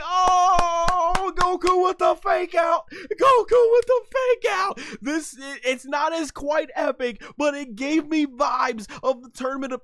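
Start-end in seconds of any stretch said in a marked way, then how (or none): 0.0-4.8 high, loud excited shout; hyped. Real, not acted. Fan cheering Goku's trick, loud excited shout. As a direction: rise with pure hype. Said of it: "And I'm like".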